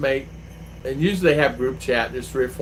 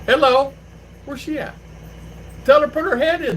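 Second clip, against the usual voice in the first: high, loud and eager; loud cheerful hello down the line; excited phone greeting; 3 dB louder than usual. Real, not acted. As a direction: greet her brightly over the phone. Greeting friend on phone loudly.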